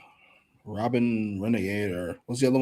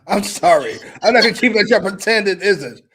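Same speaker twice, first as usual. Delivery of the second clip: laughing rant; 8 semitones higher, 11 dB louder than usual. Real, not acted. Ranting about creepy picture, laughing.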